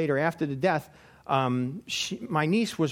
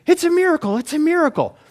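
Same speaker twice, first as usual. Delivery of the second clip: much higher, loud joyful cry; hammed-up astonished pilot; imitating an amazed exclamation; mock awe; 11 semitones higher, 7 dB louder than usual. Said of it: "she says".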